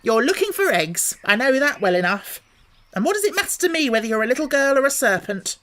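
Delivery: high, loud insistent bird voice; accusing pigeon. A narrator's reading. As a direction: refuse to be fooled. Pigeon pressing Alice.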